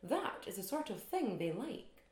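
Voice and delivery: put-on refined Edinburgh lady accent; Miss Brodie's Edinburgh voice. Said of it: "said Miss Brodie in her best Edinburgh voice".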